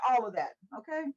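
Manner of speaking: normal talking voice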